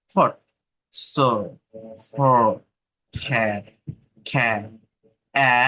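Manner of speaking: slow isolated sounds like "খ্যাট" and "অ"; vowel drill. Shown as a demonstration. Teacher drilling vowel sounds for students. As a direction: sound out each vowel slowly. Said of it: "আমি প্রত্যেকটা ওয়ার্ডের উচ্চারণ করছি".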